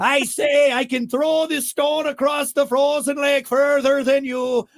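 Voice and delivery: high, loud, slow mock Scottish brogue; drunk Scotsman. Drunk Scotsman daring a rival on the frozen lake, mock Scottish brogue. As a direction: bellow a second go at a Scottish accent. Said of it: "one drunk Scotsman said to another drunk Scotsman".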